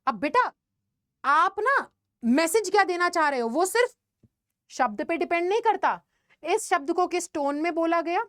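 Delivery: ordinary delivery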